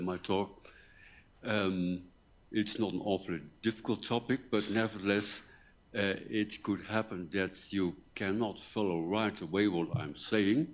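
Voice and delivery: usual speaking voice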